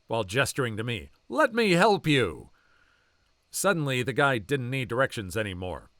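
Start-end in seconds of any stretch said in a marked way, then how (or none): 1.3-2.5 slower, louder and higher, leaning in on each word; a big guy making it clear he's here; looming protective boyfriend. Retold or imitated. Big coworker warning off the creep, slow pointed offer. Musclehead friend confronts creepy customer.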